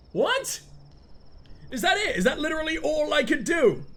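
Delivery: high-pitched disbelief; incredulous. Real, not acted. Player reacting to the game in dismay, high incredulous voice. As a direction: voice leaps up, baffled. Disbelief at what just happened.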